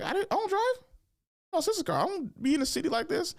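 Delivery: high-pitched mock fretting; retold panic. Lost teen fretting about getting home, high mock panic. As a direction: squeal it like a flustered new driver.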